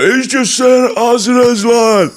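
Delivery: high mock drunk fan's voice; drunk pub bloke. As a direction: ham up a drunk admirer in a bar. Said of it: "everyone would get drunk and be like".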